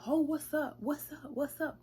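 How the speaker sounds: high, loud taunt; mock challenge to fight. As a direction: square up with the words. Ex squaring up to fight.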